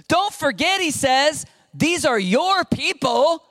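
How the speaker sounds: high, loud, desperate begging as Moses; frantic high pleading. Retold or imitated. Preacher voicing Moses begging God, high and loud. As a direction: beg God not to leave, voice cracking high.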